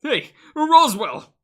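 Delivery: loud, slow, alarmed shout. Acted. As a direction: yell his name in alarm. Friend collapses on the table.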